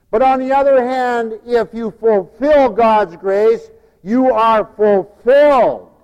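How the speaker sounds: loud, slow emphatic preaching. Acted. Preacher stressing grace to the flock, loud and slow. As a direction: drive the point home.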